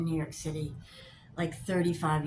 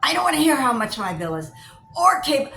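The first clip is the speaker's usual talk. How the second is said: louder and higher, fast ranting; furious phone rant; 7 semitones higher, 9 dB louder than usual. Retold or imitated. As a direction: yell at the phone robot, losing it. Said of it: "letting loose all the expletives".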